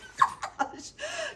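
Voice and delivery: high, helpless burst of laughter; hard laugh. A narrator's reading. Miss Mary laughing at the dragon dance, helpless laughter. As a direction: laugh so hard you can't breathe. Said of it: "Miss Mary laughs so hard".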